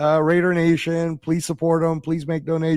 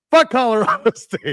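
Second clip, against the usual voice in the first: laughing taunt; 5 semitones higher than usual. Real, not acted. Joking taunt at rival team.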